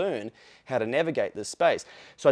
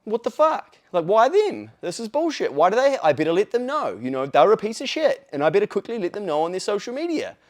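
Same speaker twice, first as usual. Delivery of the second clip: higher, louder mock indignation; mock outraged commenter; 6 semitones higher, 3 dB louder than usual. Jealous Kiwi spotting a new influencer, higher mock outrage. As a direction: ham up a jealous person fuming at someone's success. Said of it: "people were like".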